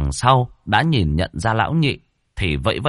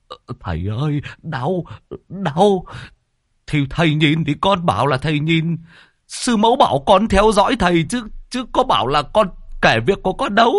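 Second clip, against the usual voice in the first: higher pained begging; pleading pupil; 9 semitones higher than usual. Acted. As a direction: whimper for mercy with ear twisted.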